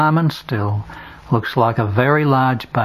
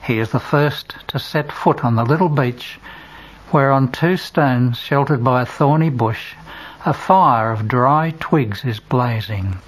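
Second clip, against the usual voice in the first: ordinary delivery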